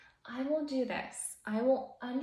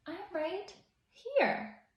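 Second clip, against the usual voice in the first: high, slow teasing answer; playful hider's reply; 8 semitones higher, slower than usual. Acted. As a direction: answer playfully from a hiding place. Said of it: "Here always laughs and says".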